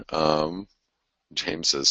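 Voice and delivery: ordinary delivery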